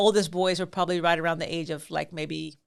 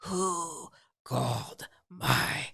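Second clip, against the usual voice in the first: creature voice; much slower than usual. Retold or imitated. Prankster as the Grither to scattering kids, eerie drawl. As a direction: stretch each word like a haunting monster.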